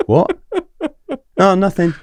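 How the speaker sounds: high, loud startled reply; startled retold exchange. Doorman confronted by the South African lad, high and startled. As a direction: act out being caught off guard.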